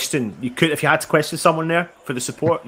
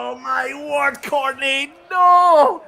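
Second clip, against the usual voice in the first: excited then anguished; 11 semitones higher, 5 dB louder, much slower than usual. Real, not acted. Another chance missed live.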